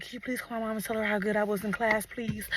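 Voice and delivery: soft, small-voiced begging; hushed polite plea; retold pleading.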